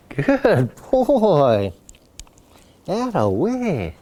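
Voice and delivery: slow, stretched "That away" of praise; praising dog. Real, not acted. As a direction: coo approval at the dog. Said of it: "I'll praise him".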